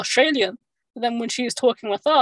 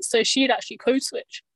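usual speaking voice

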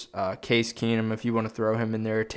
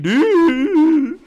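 exaggerated squeaky voice crack; 10 dB louder than usual. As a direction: voice cracking high and loud. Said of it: "that fucking voice crack".

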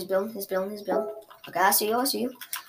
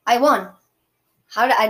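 excited shout; 4 semitones higher, 10 dB louder than usual. Real, not acted. Shouting his win in a game.

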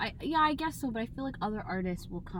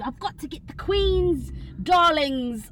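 imitating a British accent, high, loud and slow; a stranger hamming up a posh British accent; mock British accent; 5 semitones higher, 10 dB louder, slower than usual. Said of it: "putting on the British accent".

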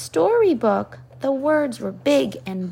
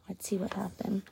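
whisper; 12 dB quieter, faster than usual. Real, not acted. Parent whispering to child mid-story. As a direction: drop to a whisper, let's see. Parent reading aloud, aside.

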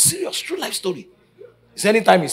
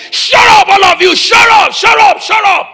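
screaming shut up at a noisy family; shrieking at the quarrelling house; furious shout; 18 dB louder than usual. Retold or imitated. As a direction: yell it high and loud, ordering the family silent. Pastor's wife yelling at family.

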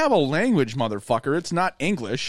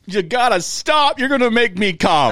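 raised, pleading cry to stop; mock pleading shout; 6 semitones higher, 4 dB louder than usual. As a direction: beg them to stop, half joking. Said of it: "scream that in a different language".

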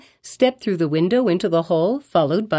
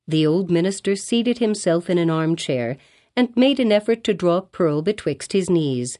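ordinary delivery

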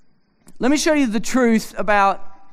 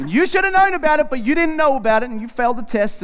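laughing gloat; 7 semitones higher, faster than usual. Retold or imitated. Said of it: "continues gloating at wife".

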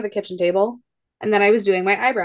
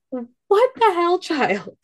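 higher, slow, drawn-out disbelief; mock exasperation; 9 semitones higher, much slower than usual. Mom stunned by toddler's comment, slow mock disbelief. Mom reacting to daughter's bluntness.

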